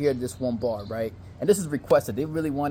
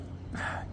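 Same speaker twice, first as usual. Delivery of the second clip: soft, acted sigh of annoyance; exasperated sigh; 13 dB quieter than usual. Man reacting to his date's noise, exasperated sigh. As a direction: let out a weary, unvoiced breath.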